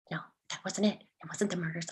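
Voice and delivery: hushed, low correction; quiet self-doubt. Acted. Storyteller second-guesses the mom's answer, quiet and doubtful. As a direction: drop right down, doubting it under your breath. Correcting herself after awkward line.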